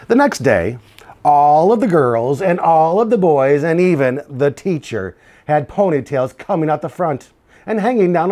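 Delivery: lively picture-book read-aloud; reading to children. A narrator's reading. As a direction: tell it to a class of kids.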